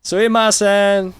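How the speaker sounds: loud sumimasen. Pretend. Mocking a foreigner who yells sumimasen, a loud polite shout. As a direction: blast "Sumimasen" at a Japanese stranger. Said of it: "really loud in every conversation".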